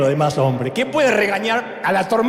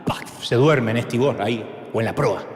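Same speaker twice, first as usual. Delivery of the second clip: normal talking voice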